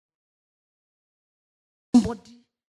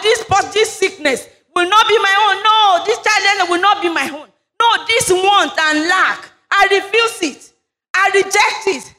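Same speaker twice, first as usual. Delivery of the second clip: rising, higher preaching prayer building to a shout; fervent prayer; 7 semitones higher than usual. Acted. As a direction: pray it out, climbing to full force. Pastor leads fervent prayer.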